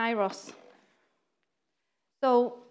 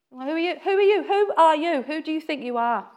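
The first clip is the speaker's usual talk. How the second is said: indignant crowd challenge; 5 semitones higher, 5 dB louder than usual. Retold or imitated. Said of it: "they were obviously all, all saying it".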